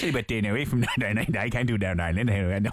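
low, laughing Northern Irish try; badly faked Northern Irish, laughing; Northern Irish accent. Shown as a demonstration. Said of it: "do the Northern Ireland accent".

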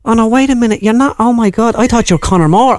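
comic high Dublin voice; north side Dublin accent. Acted. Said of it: "a North Side dub".

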